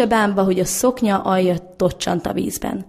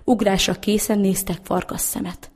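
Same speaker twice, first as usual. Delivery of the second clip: ordinary delivery, nothing marked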